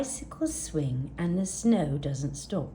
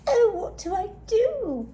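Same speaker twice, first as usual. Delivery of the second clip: tearful; 12 semitones higher, 3 dB louder than usual. Acted. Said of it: "Gertie whispered".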